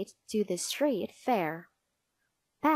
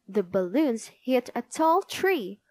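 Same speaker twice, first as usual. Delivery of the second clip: plain reading voice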